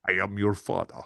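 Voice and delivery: ominous masked villain; menacing, weighty movie-villain voice; Darth Vader. Acted. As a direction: deliver it as a grave, resonant space villain. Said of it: "we need a bit of Darth Vader".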